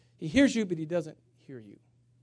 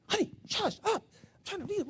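impatient hushing; 4 dB quieter than usual. Retold or imitated. Hushing wife while on phone.

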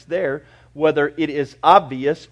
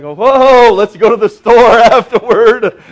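loud, high, laughing "whoa"; hammed-up eager listener, laughing; mock excited congregation; 6 semitones higher, 13 dB louder, slower than usual. Pastor mimics the congregation craving Oreos, loud and laughing. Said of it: "you're like".

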